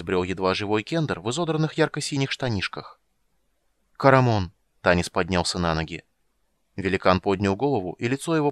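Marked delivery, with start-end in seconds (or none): 4.0-4.5 loud joyful call. Acted. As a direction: call his name across the camp. Calling out to a friend.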